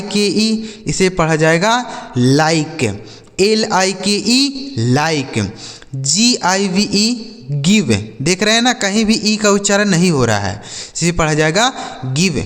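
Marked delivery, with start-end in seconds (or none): none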